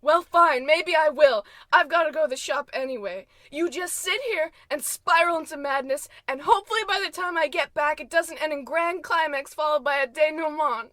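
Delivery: loud, quick, annoyed exit line; huffy retort. Acted. Wife snapping at George as she leaves, loud. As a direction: storm off with a sharp tongue.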